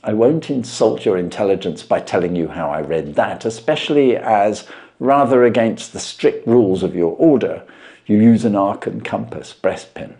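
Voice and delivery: measured, knowing detective voice; Holmes voiced. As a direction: lay out the deductions with calm assurance.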